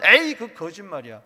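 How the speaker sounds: loud mock scoff at the sermon; imitating a scornful churchgoer; scoffing dismissal. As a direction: brush it off as a lie, loudly. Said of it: "막 이러면서".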